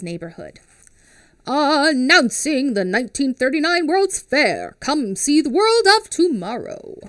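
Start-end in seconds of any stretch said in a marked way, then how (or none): 1.5-7.0 loud, high radio announcer call; booming fairground announcer. Acted. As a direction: announce it like an old-time radio ad. Announcer voice for World's Fair.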